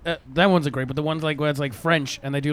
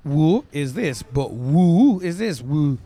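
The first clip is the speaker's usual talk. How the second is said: mock Chinese tones; 2 semitones higher, 6 dB louder, slower than usual. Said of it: "Of Chinese people taking the piss".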